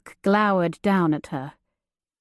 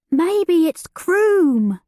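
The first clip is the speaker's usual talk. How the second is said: higher, slow lazy outlaw voice; slow drawling outlaw; 9 semitones higher, 4 dB louder, much slower than usual. Acted. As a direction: drawl it out with a high lazy lilt. Outlaw at the campfire.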